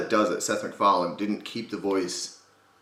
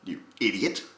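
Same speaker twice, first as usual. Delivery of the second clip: Stewie Griffin. Acted. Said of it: "What video do you explain Stewie's voice?".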